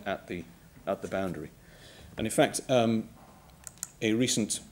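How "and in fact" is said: ordinary talk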